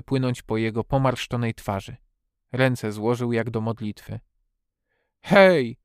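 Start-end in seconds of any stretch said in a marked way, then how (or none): 5.2-5.8 loud, high exclamation; sudden cry; outburst. A narrator's reading. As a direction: burst out with it. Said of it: "wybuchnął".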